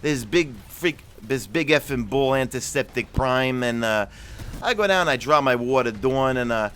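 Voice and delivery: loud, hammed-up New York tough talk; turned-up New York accent. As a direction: lay the New York accent on thick, loudly. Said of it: "really turn up the New York accent".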